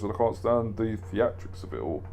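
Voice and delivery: ordinary delivery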